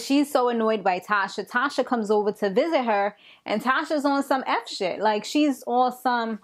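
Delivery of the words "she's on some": ordinary talk, nothing marked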